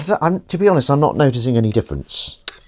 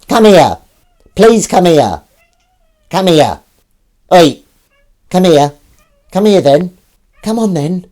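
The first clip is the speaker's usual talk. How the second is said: high, loud repeated pet call; coaxing the cat over; 8 semitones higher, 7 dB louder, slower than usual. Real, not acted. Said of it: "I'm trying to get her to come here".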